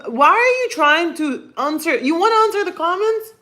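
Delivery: high-pitched questions to a pet; high, mock-scolding cat talk; playful pet teasing. Real, not acted. Teasing the cat about comments.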